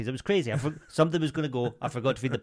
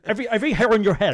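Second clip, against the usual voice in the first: Andrew Tate impression; 7 semitones higher, 6 dB louder, faster than usual. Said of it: "Andrew Tate, innit?".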